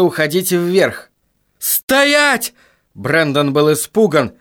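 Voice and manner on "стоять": sudden high commanding shout. Acted. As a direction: bark the order to freeze. Brandon orders the killer to stop.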